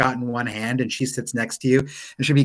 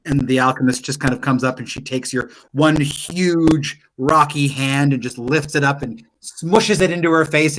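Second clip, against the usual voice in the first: ordinary talk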